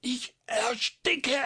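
very high, strangled plea; squeezed falsetto cry of a choking man; choking groan. A narrator's reading. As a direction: groan for help as you suffocate. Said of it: "ächzte der Doktor".

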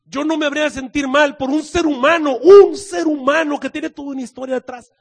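loud, rising impassioned plea; God pleading for Nineveh, loud and impassioned; fervent preaching. Acted. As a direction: preach it at full force. God's plea, preached at full force.

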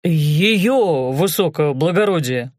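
slow, proud, savoring each word; quiet pride. Acted. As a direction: say it with hidden pride. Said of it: "с затаённой гордостью".

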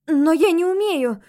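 high, loud objection; indignant protest. A narrator's reading. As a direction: protest it loudly, taken aback. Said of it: "возмутилась я".

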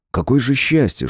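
ordinary delivery

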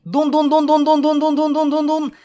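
high chattering dun-dun-dun; imitated gunfire. Pegasus ending her radio message under fire, vocal gunfire. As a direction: fire a pretend machine gun with the voice.

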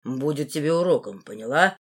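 low, restrained anger; angry. A narrator's reading. Руфус teaching the heroine a lesson, angry. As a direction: warn her in a low, angry man's voice. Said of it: "сказал он со злостью мне".

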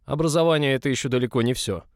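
scornful director laying down the law; sneering boss. A narrator's reading. Director dismisses girl's praise of newcomer, loud sneering voice. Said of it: "криво усмехнулся директор".

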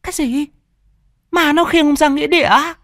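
kêu lên kinh hãi, giọng vợ cao vút; horrified cry. A narrator's reading. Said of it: "hét lên kinh hãi". (vợ nghe chồng kể chuyện, hét lên kinh hãi)

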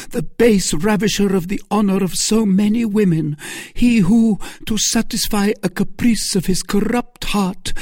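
high, loud denunciation; denouncing Milady. Acted. Milady denouncing Buckingham to Felton. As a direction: pour out her accusation.

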